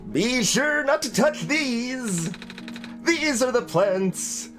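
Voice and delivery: talking plant proclaiming with slow, theatrical stretches; potted plant voice. Acted. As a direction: put on a big voice for a talking plant. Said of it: "what's a voice for a potted plant".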